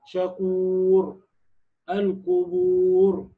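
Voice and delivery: very slow, long level waw into heavy ر in شكور, القبور; heavy final ra. Shown as a demonstration. Teacher chanting examples of the rule, heavy ra in شكور, القبور. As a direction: hold the vowel of شكور and القبور, ending on a thick ر. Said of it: "هتفخم الراء الساكنة في الوقف".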